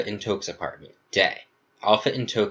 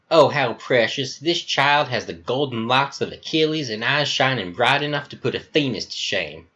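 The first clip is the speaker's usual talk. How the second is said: higher-pitched, effusive American Southern voice; flamboyant Southern accent; 4 semitones higher than usual. Acted. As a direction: gush theatrically in a Southern accent.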